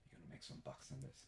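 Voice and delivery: unvoiced, fast whisper; hushed confidential tip; whispered aside. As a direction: lean in and whisper it. Director's aside.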